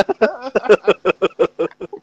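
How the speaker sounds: real helpless laughter; high laugh. Real, not acted. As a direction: burst out laughing at the joke. Cracking up at toilet joke.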